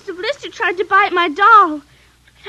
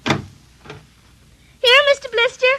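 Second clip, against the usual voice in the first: high, sweet call to a puppy; little girl calling her puppy; calling the dog; 9 semitones higher, slower than usual. Acted. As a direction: coax the dog over in a high voice.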